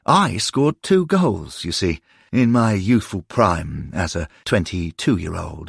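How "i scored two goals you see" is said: ordinary delivery, nothing marked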